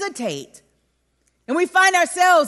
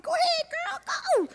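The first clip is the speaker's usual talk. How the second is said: much higher, squealing encouragement; excited egging on; 7 dB quieter than usual. Retold or imitated. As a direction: push your friend forward, giddy. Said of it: "My friends was like".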